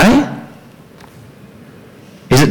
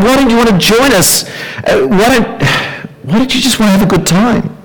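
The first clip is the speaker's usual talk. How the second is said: higher, baffled mock voice, quick in places; mildly incredulous party-goer asking why; voicing their puzzled friends; 9 semitones higher than usual. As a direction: plead like a friend who can't see the problem. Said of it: "And so they ask us".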